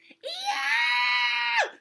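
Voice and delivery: long, very high, loud scream; full-out shrieking wail; piercing scream. A narrator's reading. Turtle throws a tantrum, screaming. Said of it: "screamed Turtle".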